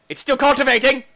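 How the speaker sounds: high, loud exclamation; exasperated shout. Real, not acted. Player waiting on the machine, exasperated shout. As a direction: call it out loudly in exasperation. Impatient announcement of the wait.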